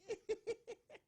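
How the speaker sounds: high-pitched giggle; high laugh. Real, not acted. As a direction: burst into a squeaky laugh. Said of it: "in my Auntie Betty's voice".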